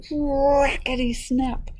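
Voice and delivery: high, slow, drawn-out cracking words; cracking egg. Acted. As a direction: stretch it like a shell splitting. Second egg cracks open.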